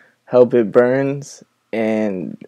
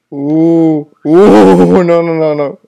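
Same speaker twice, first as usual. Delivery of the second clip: scared laugh; 3 semitones higher, 6 dB louder, much slower than usual. Real, not acted.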